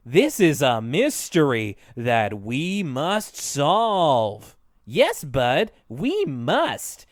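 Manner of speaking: very slow, drawn-out mock-mystery delivery; hammed-up, ponderous detective talk; weird way of speaking. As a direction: imitate stilted storybook sleuths, stretching each word. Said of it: "such a weird way of speaking".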